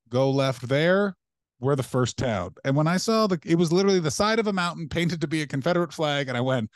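ordinary talk, nothing marked

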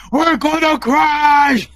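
slow, loud mock panic; mock panicked yell. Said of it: "all I see is".

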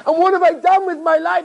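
acted cry of self-reproach; anguished outcry. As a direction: wail the question out in regret. Acted rabbi repeats the question, anguished.

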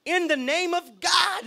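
high, slow, drawn-out invocation; hammed-up pious demon plea; mock religious demon. Demon getting religious on Jesus, mock pious cry. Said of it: "tried to get even religious on Jesus".

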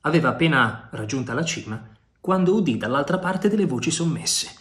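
ordinary delivery, nothing marked

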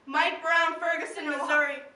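loud, forceful naming of the dead; shouted roll call. Acted. Poet names a slain man and town, shouted roll call. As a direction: call the name out for all to hear. Calls out a victim's name.

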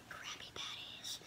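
hushed secret whisper. Pretend. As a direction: breathe it like a secret. Whispering over favorite snack.

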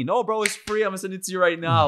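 high-pitched, insistent assurance; eager reassurance. Retold or imitated. Photographer telling strangers the photo is free, eager high-pitched reassurance.

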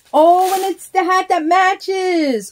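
high, loud, slow delight; thrilled squeal. Real, not acted. Finding the matching hat in her happy mail, she squeals. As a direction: gush over the matching hat.

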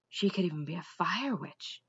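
the king muttering his worry to himself. A narrator's reading. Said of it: "he said to himself".

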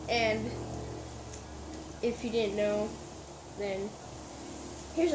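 smiling while speaking; giggling with a grin; laughing. Real, not acted. Seen 0: No chewing visible; he grins and laughs, pickle held in hand.

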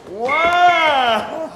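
loud, high amazed shout; real excited coach cheer; excited wow. Real, not acted. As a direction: burst out impressed by the class. Coach reacting to fast finish.

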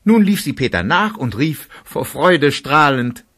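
normal talking voice

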